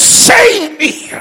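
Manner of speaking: high, full-volume scream for rescue; crying out. Acted. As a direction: belt it out as the climax of the plea. Climax of acted cry for rescue.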